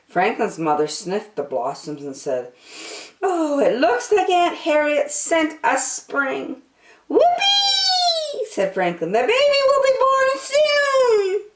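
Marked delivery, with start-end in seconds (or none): 3.2-6.6 high, pleased over the flowers; happy surprise at the gift; delighted mother. Acted. Mother sniffing Aunt Harriet's blossoms, delighted. 7.1-8.5 very high, joyous whoop; excited cheer. Acted. Franklin cheering about spring, high whoop. 9.1-11.5 very high, gleeful and louder; almost squealing with happiness; overjoyed child. Acted. Franklin celebrating the coming baby, high and gleeful.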